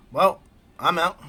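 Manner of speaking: cheerful exit. Acted. Jiraiya leaves cheerfully.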